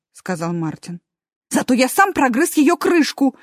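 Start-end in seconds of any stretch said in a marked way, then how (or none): 1.5-3.4 higher, louder, suddenly lively; perking up. Acted. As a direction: brighten up with pride. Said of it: "встрепенулся мёртвый хомячок". (оживлённо)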